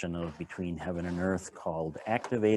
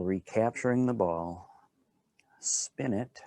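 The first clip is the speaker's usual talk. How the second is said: unhurried, calm guiding voice with long pauses; guided qigong instruction; 6 dB quieter than usual. Acted. Teacher guiding a standing qigong class, calm and unhurried. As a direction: talk the class gently through each movement.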